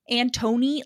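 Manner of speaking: Antony said as "an-TOE-nee"; Antony with a full "toe" in the middle; Antony as An-Tony. Shown as a demonstration. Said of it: "it sounds weird to me".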